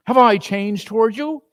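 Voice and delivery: put-on young Scrooge protesting to his fiancée; pleading young Scrooge. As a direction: insist you never wanted out.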